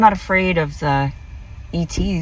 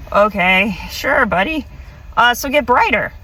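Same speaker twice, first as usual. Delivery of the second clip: skeptical; 7 semitones higher, 3 dB louder than usual. Retold or imitated. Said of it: "And I'm thinking".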